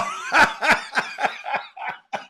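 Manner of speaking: long, breathy high cackle; real laugh. Real, not acted. Host cracks up after tripping over a name, laughing. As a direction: burst out laughing.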